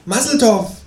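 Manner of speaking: high-pitched kangaroo figure. Acted. Kangaroo greets its human flatmate out of nowhere, high creature voice. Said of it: "sagt das Känguru".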